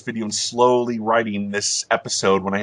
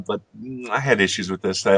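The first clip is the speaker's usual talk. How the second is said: usual speaking voice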